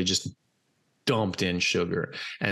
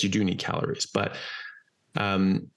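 ordinary talk, nothing marked

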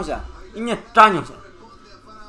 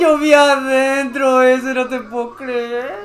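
slow, stretched lament; drawn-out disbelief; slower than usual. Real, not acted. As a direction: drag the words out, fading in disbelief. Disbelief after a near miss. (lamento incrédulo y alargado)

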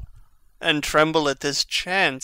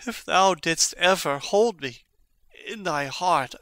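usual speaking voice

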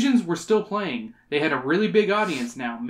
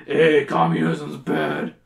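very low, slow put-on voice; mock-solemn low pronouncement; hammed-up speechmaker; 12 semitones lower, 3 dB louder, slower than usual. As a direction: drag it out deep and slow. Hammed-up voice of the speaker.

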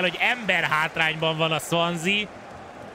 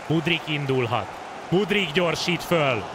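excited Hungarian sports commentary; 8 semitones higher than usual. Acted. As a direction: urge him on, climbing higher. Urging player on a run.

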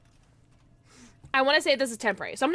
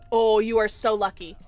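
ordinary talk, nothing marked

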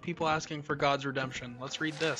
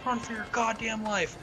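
usual speaking voice

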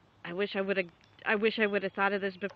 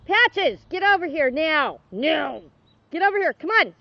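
loud, higher stern call to the dog; commanding the dog; 7 semitones higher, 8 dB louder than usual. Real, not acted. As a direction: order the dog over, insistent. Ordering dog to come.

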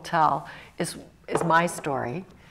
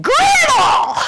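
put-on full-force, very high child's shout; huge Southern-accented holler; louder retry; 12 dB louder than usual. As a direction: yell the name to reach the back row. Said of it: "I must not have been loud enough".

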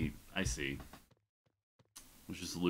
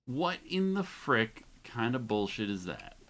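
higher and louder indignant complaint; outraged at the unfair fight; real annoyed outburst; frustrated rant; 6 semitones higher, 6 dB louder than usual. Real, not acted. Enemy won't die.

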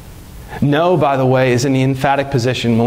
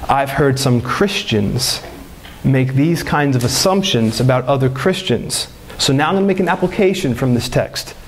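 normal talking voice

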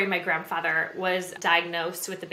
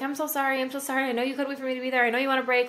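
higher, rushed stream of apologies; apologetic husband; 6 semitones higher, faster than usual. Retold or imitated. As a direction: gush sorry quickly, lightly voiced husband. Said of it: "he's like".

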